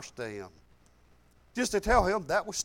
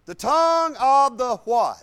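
louder, slow preacher's question left hanging; call-and-response prompt; 4 semitones higher, 10 dB louder than usual. Real, not acted. The preacher quizzes the church on the verse, prompting. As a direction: draw the answer from the congregation. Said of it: "What's it say?".

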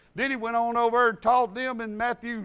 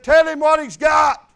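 loud, higher shout; retold shout; 5 semitones higher, 11 dB louder than usual. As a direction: bark it out across the gas pumps. Man at gas pump yells.

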